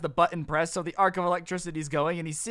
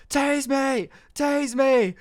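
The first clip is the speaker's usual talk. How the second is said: high mock yell, louder; retold scream; 7 semitones higher, 4 dB louder, much slower than usual. Shirtless friend yelling at the thirteen-year-old, mock scream. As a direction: shout it at the kid, hammed up. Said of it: "a grown man screaming at him".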